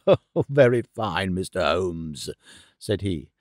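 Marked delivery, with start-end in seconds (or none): 0.1-2.3 put-on old professor's lower voice, falsely amused; feigned amusement. A narrator's reading. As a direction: act amused through an insincere laugh. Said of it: "burst into insincere laughter".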